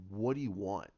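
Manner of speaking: low, level voice; retold Batman reply. Batman turning to Superman, low retold reply. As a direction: ask it low and weary of visitors.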